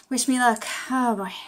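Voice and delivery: usual speaking voice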